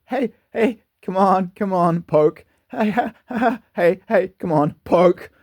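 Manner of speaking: put-on high, taunting child's voice with jeering laughs; squeaky high kid taunting, laughing in your face; mocking bully kid. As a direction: jeer like an arrogant six-year-old poking a bear. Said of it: "Like this stupid, arrogant, bully, little six-year-old".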